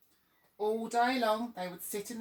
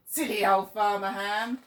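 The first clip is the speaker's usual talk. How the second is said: louder mocking crow chant; loud sing-song jeer; jeering shout; 8 dB louder than usual. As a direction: crows taunting the farmer once more.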